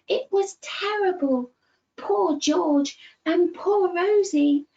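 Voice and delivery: lively, animated storybook voice; reading to children. Acted. Storyteller reading George's adventure to little ones, animated. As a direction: tell it like a bedtime tale full of wonder.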